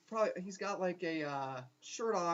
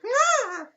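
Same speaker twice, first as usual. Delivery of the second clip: short, high, loud cackle; sudden mock shriek of glee; imitated cackle; 14 dB louder than usual. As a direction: burst out in a creepy high squeal. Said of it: "just cackling at me".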